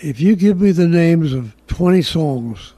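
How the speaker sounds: ordinary talk, nothing marked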